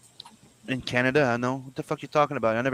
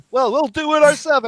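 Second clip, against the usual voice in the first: high, mock smug reply; smug translators; 7 dB louder than usual. Translators answering Japan, mock smug. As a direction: sneer it like a translator. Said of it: "here they go like".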